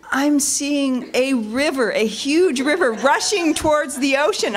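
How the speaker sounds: slowly conjure the river to tease; mock dreamy visualizing. Colleague teasing her with water imagery, slow dreamy. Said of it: "Jav will go".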